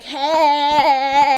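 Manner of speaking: long drawn-out teasing "hey"; mock party call. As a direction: imitate a woman whooping as she twerks. Imitates the twerking woman's party call.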